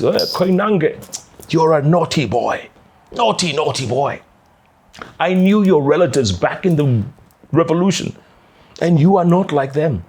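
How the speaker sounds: high, mock-stern telling off of a naughty boy; scolding president. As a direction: tease him like a stern headmaster. President scolding reporter in story.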